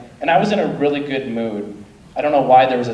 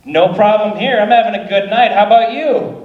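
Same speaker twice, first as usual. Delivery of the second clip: mock cheerful; 6 semitones higher, 4 dB louder than usual. Cheerful reply to the yeller.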